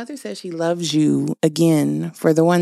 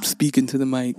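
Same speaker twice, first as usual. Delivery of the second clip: low-pitched "Speaking to the mic"; playful low voice for a mic test; low mic check; 6 semitones lower than usual. Pretend. Testing the microphone, playful repeat.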